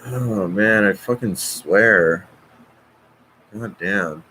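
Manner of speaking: usual speaking voice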